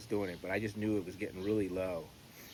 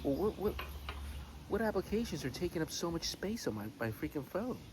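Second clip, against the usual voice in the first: exasperated; 8 semitones higher than usual. Retold or imitated. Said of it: "and I'm like".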